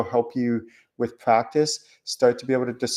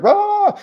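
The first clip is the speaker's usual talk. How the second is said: high, loud "rah" of racing thoughts; frantic outburst; 7 dB louder than usual. Retold or imitated. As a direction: blurt a sudden high-pitched "rah". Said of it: "they're fast, they're like".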